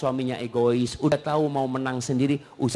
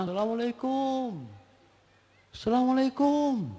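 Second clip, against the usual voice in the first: husband calling salam; 9 semitones higher, 5 dB quieter than usual. Acted. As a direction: call out the greeting like a husband waiting outside. Husband calling at door unanswered.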